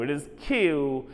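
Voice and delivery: Q said slow and soft, the long kyoo; the right Q, slow and careful; correct Q. Shown as a demonstration. Teacher giving students the right Q, slow and soft. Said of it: "Do not read as Q".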